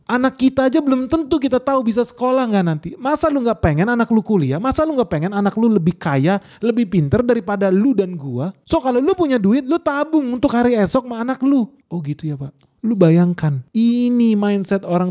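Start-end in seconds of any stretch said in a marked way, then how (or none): 0.1-11.7 high-pitched imitated husband lecturing his wife. Old man lecturing his wife about their child's future, high mock voice. 11.8-12.6 meek wife. Retold or imitated. Wife meekly accepts.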